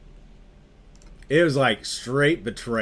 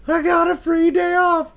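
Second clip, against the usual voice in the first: much higher, a hammed-up tearful whine; mock crying whine; 6 dB louder than usual. Young sailor in tears over wasabi, mock sob. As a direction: whimper it like a blubbering kid. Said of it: "I was crying".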